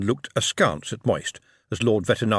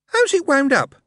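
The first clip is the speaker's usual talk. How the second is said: put-on child's voice; 6 dB louder than usual. A narrator's reading. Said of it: "asked the boy".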